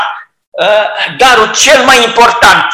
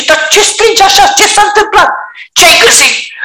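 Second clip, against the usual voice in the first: higher voiced mother, surprised; a mother puzzled by the shouting; mother wondering what happened; mother asking; 11 semitones higher than usual. Mother voiced answering the child.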